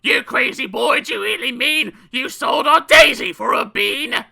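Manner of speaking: high, loud shrieking mother; crying out. A narrator's reading. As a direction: shout it in outraged disbelief. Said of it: "leaped high up in the air and cried".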